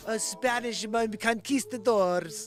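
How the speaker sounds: mock Hilaria. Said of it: "she's like".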